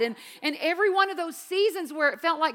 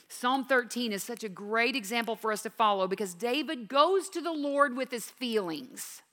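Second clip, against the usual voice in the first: normal talking voice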